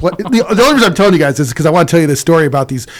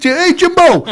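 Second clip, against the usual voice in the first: much higher, calling across the bar; 14 semitones higher, 3 dB louder than usual. Retold or imitated. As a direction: call out to Jimbo playfully. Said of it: "calling out to Jimbo".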